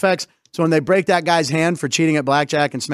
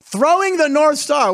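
grand echo; 9 semitones higher, slower than usual. Pretend. Echoes the flag caption grandly.

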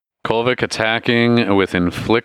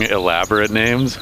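ordinary talk, nothing marked

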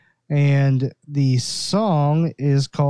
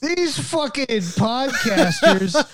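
high, slow mock indignation; hammed-up disgust, drawing out each insult; mock mad; 7 semitones higher, slower than usual. Scientists griping about the podcasters who saved them, mock outraged. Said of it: "they're, like, super fucking mad".

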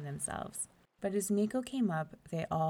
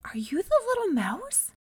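higher, louder astonished question; amazed Meeko; 12 semitones higher, 7 dB louder than usual. Acted. Meeko asks the princess in amazement, raised and louder. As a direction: gasp it in wonder.

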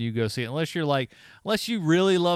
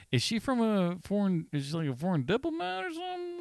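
mock bewilderment; 9 semitones higher than usual. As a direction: act out a baffled viewer, pitch climbing. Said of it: "I'm like".

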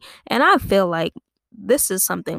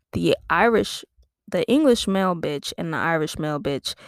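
ordinary delivery

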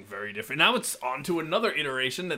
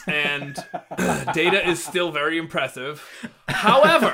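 laughing; 6 dB louder than usual. Real, not acted. Breaks into laughter.

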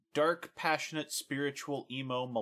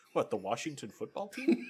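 ordinary delivery

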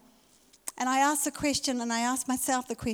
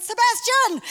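higher, louder call across the yard; yelling a dog's name; 10 semitones higher, 7 dB louder, slower than usual. Retold or imitated. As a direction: call the dog back, shouting. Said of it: "I am yelling".

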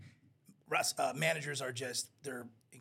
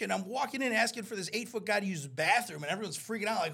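high, loud incredulous complaint; exasperated retelling; 6 semitones higher, 7 dB louder than usual. Retold or imitated. Wrestling manager recounting chaperoning a giant, exasperated. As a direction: act out a flustered manager's disbelief.

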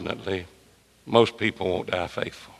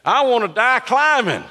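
preaching emphasis; 12 semitones higher, 4 dB louder than usual. Real, not acted. Preacher's emphatic opening declaration.

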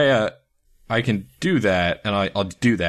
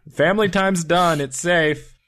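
high-pitched announcement to someone away; calling out; 8 semitones higher than usual. Acted. Telling Zafir family time is done, calling out. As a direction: call them back in with raised pitch.

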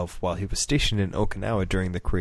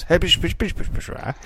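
mock Japanese gibberish; 9 semitones higher than usual. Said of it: "he just goes".

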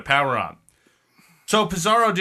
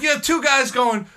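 ordinary delivery

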